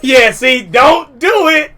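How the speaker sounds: slow, shouted warning to a friend; loud warning shout. Real, not acted. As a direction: yell it out slowly, don't do it. Warning friend not to confess.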